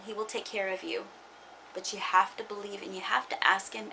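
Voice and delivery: ordinary talk, nothing marked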